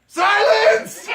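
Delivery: sudden high loud command barked out in his sleep; sleep-talking shout. Retold or imitated. Friend recalls sleep-talk in their tent, shouting. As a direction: boom it like a commanding ruler. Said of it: "and then you said".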